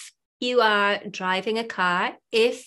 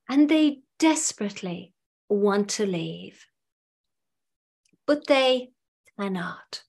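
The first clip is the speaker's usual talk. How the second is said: guided visualization. Acted. Hypnotherapist guiding listeners to picture their ideal clients, guided visualization. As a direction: lead the listener gradually inward, phrase by phrase, pausing long between.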